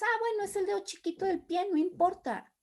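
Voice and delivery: high, quick, soft brush-off; fingida indiferencia. Acted. Imita restarle importancia al dolor. (tono despreocupado fingido, agudo y rápido)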